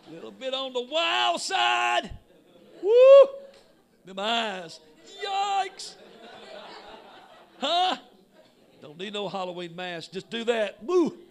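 high, loud, laughing teasing jeer; teasing a spooky stranger, high and loud with laughs; mocking taunt. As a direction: poke fun at her, whooping. Preacher taunts the demonic woman.

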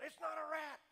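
high, loud heckler's yell; shouted objection. Retold or imitated. Imitating the heckler yelling from the audience, loud high shout. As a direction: bark the correction at the speaker. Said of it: "He yells it out".

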